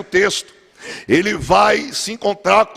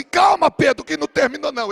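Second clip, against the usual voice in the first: calling out; 7 semitones higher than usual. Acted. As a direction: shout it over the storm. Jesus calling out to Pedro.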